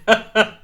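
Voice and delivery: loud laugh breaking out; laugh, cracking up; burst of laughter. Real, not acted. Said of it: "keep a straight face".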